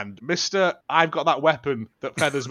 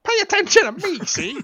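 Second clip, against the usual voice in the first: high squeaky mock mobster voice, louder; squeaky gangster; 4 dB louder than usual. Imitating Kovac's mate demanding attention, high squeaky gangster. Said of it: "Kovac's mate is like".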